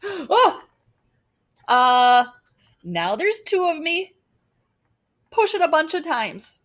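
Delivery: high, loud surprised voice. Acted. Larry sees two of himself, loud and surprised. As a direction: exclaim in amazement.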